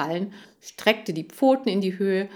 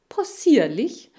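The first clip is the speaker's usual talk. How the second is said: loud, drawn-out incredulous question; high, affronted disbelief; indignant echo; 8 semitones higher, 7 dB louder, slower than usual. Acted. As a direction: throw the word back in disgust. Hamster narrator repeats word, offended.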